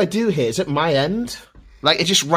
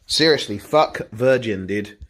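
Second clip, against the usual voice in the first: ordinary talk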